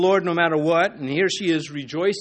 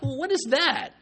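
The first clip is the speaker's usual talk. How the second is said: Isaiah scoffing; 11 semitones higher than usual. Retold or imitated. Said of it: "And Isaiah's like".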